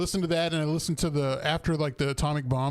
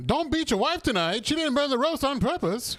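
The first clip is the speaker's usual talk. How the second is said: put-on old radio announcer; 10 semitones higher than usual.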